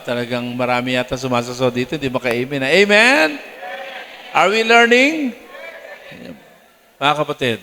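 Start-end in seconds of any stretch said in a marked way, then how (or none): 2.7-5.3 preacher prodding the congregation again; preacher's call. Real, not acted. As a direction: prompt the crowd. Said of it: "calling for response".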